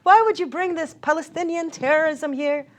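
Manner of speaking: high, loud re-enacted shouting; yelling at the girl at the table; angry yelling. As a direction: lash out at her full force. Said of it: "just standing there yelling at her".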